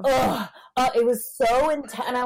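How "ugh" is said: imitating a loud sex groan, higher; mock moan. Imitating a loud partner's groan to friends. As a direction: ham up his groan. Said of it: "he was like, he was like".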